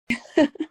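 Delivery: a quick burst of laughter; short real laugh. Real, not acted. Client laughing after answering her coach. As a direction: laugh out at your own answer.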